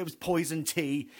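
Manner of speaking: ordinary delivery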